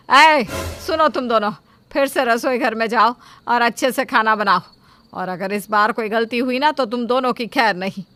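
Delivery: loud, sharp scolding; angry threat. Acted. Mother-in-law threatening the daughters-in-law, loud and angry. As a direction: warn them they're in trouble.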